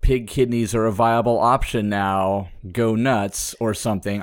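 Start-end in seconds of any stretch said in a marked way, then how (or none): none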